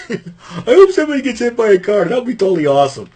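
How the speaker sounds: gleeful Raven impression, high and quick at the end. Retelling Raven's commentary, high mock glee. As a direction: ham up Raven's morbid glee.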